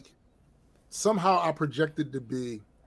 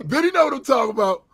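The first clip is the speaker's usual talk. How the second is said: higher, louder, fast sing-song of a beggar; mock caveman quote; 8 semitones higher, 5 dB louder, faster than usual. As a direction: ham up her broken English. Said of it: "She out there sound like Captain Caveman".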